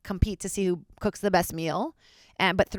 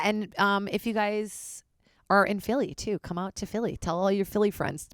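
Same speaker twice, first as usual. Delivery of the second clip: ordinary talk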